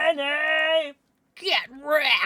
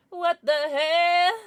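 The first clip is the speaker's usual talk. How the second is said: high, loud, drawn-out disbelief; shocked; 6 semitones higher, 7 dB louder, much slower than usual. Real, not acted. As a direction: stretch it out in shock. Shock at donation.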